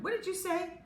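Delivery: high challenging question; menacing bully. A narrator's reading. Max confronts the narrator, high menacing. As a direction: dare him to repeat it.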